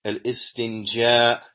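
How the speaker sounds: slow al istinja, every sound clear; careful pronunciation. Shown as a demonstration. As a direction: model al istinja slowly for students. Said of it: "this is how it should be pronounced".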